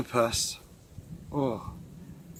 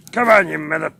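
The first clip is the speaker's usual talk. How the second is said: calling the dog; 3 semitones higher, 10 dB louder than usual. Real, not acted.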